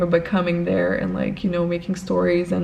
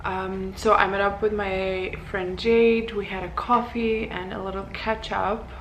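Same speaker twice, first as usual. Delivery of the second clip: soft, odd-sounding voice just after breakfast; a just-woken voice that sounds off; strange morning voice; 6 dB quieter than usual. Real, not acted. Greeting viewers after breakfast, her voice sounding a bit strange and soft. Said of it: "my voice sounds a bit strange".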